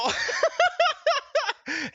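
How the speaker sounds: high, loud burst of laughter. Real, not acted. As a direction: crack up loudly. Laughs after saying dismal.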